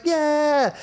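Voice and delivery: high, drawn-out mock cheer; a whole crowd's yeah. Retelling an audience answering the host, mock cheer.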